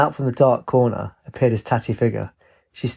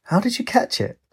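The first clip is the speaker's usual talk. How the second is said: high incredulous question; can't believe he caught it; amazed squeal of a question, much higher; surprised; 9 semitones higher than usual. A narrator's reading. Katie asks, surprised.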